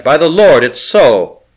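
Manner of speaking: leaps up high and loud with hope; sudden hope. A narrator's reading. Larry catches new hope from his friend, loud and high. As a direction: burst out as hope returns.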